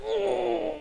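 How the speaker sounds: high, drawn-out recoil; startled disgust. Retold or imitated. Retold reaction to being spat at.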